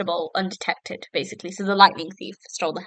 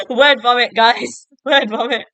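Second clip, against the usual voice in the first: louder, higher, breaking into laughter; laughing; 3 semitones higher, 6 dB louder than usual. Real, not acted. Vlogger to viewers after fumbling words, laughing. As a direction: laugh through the words at your own slip. Laughs at own slip of tongue.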